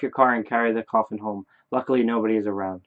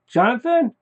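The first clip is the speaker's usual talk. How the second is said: high, loud call of a name; questioning shout; 12 semitones higher, 6 dB louder than usual. Acted. Father shouts his deceased son's name, high questioning shout. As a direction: shout it upward as a startled question. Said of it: "After you shout your deceased son's name".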